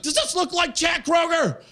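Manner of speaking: very high, indignant comic yell; mock outraged shout. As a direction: demand it at the top of your range. Indignant retort about his shirt.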